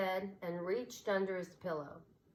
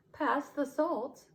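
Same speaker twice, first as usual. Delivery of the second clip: higher, slow owl request; pepper owl; 8 semitones higher, 4 dB louder, slower than usual. A narrator's reading. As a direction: ask for salt politely. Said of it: "said the pepper owl".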